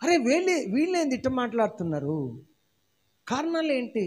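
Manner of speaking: hushed mock astonishment; surprised leaders. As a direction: play the startled leaders asking why. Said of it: "వాళ్ళకి ఆశ్చర్యం అనిపిస్తుంది".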